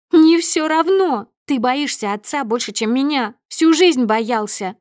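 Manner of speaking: high, loud, stretched-out accusing cries; piercing, siren-like. A narrator's reading. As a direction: wail accusations at your husband. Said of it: "становится пронзительным, как сирена". (пронзительно, с криком, как сирена)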